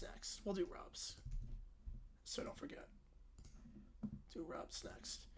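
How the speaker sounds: unvoiced whispered reminder; quiet whispered note to a co-host. Real, not acted. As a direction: whisper it aside off mic. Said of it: "whispered aside off mic".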